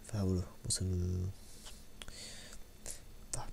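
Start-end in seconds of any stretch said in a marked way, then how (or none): none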